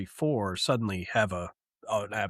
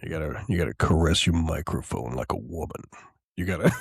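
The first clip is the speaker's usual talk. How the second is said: deep joke voice; 8 semitones lower than usual. Pretend.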